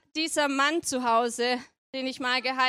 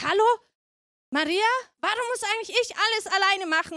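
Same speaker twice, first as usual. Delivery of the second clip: higher-pitched indignant complaint; meckernd; 7 semitones higher, faster than usual. Acted. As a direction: grumble at Maria, pitch lifted. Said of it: "wird ganz schön viel gemeckert". (empört meckernd)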